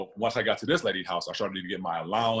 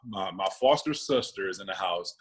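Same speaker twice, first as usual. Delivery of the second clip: ordinary delivery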